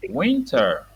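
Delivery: usual speaking voice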